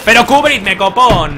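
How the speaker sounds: cover me shout. Real, not acted. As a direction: yell for cover. Yelling at team for cover. (gritando a los compañeros que le cubran)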